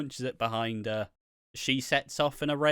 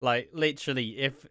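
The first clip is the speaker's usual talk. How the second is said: normal talking voice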